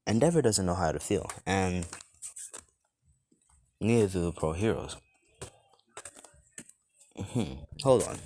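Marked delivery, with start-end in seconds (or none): 3.8-8.2 talking while eating; mouth full. Real, not acted. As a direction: speak around a bite of food. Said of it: "Sorry, I was eating something".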